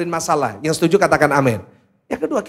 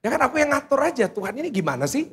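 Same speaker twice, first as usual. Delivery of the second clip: higher, fast, exasperated complaint to God; grumbling prayer; 7 semitones higher, faster than usual. Acted. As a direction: rattle it off like someone fed up with God.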